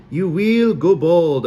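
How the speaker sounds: gleeful mock Sully prophecy; drawn-out fake Sully curse; bad Sully accent. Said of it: "My accent was even worse than Mal's".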